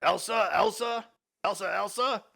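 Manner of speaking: worried calls for Elsa; calling out. Acted. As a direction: call her name through the door. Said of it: "knocks and calls out".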